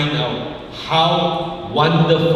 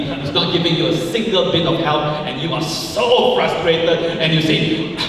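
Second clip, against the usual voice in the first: normal talking voice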